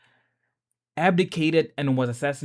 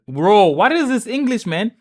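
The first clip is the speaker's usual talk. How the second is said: louder, fed-up outburst at the wording; exasperated complaint; 4 semitones higher, 9 dB louder than usual. Real, not acted. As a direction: vent at the big words. Frustrated reading hard words.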